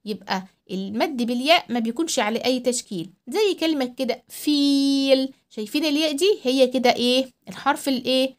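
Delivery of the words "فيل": فيل with a drawn-out ii; long ii in فيل. Shown as a demonstration. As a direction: stretch the ii of فيل way out. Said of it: "هنطول صوتنا قوي".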